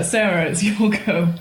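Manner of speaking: ordinary talk, nothing marked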